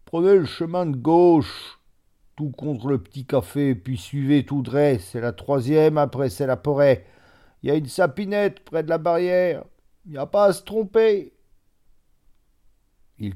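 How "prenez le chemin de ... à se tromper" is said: much higher, louder village voice calling back; a farrier shouting the way from the forge; a loud country man pointing the road; peasant giving directions. A narrator's reading. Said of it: "Un des hommes répondit".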